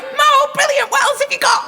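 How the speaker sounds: high, gleeful mock delight; delighted reply. Comedian mimicking her delighted reply to the insult. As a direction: squeal it like you've found a treasure. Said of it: "So I'd be like".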